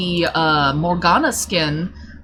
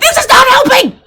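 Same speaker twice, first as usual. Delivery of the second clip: much higher and louder, wailing; tearful shout; 11 dB louder than usual. Retold or imitated. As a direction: yell it through tears at everyone. Crying amid screaming players.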